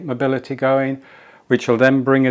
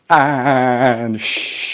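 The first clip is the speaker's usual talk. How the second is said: shushing exhale. Shown as a demonstration. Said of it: "Whatever sound you want to make".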